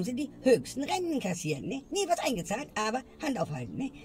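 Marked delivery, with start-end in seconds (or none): none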